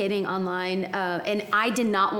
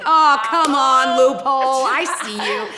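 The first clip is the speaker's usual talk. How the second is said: loud, drawn-out protest; mock indignant outburst; 4 semitones higher, 9 dB louder, slower than usual. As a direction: call out his excuse with theatrical exasperation. Retold calling out his excuse.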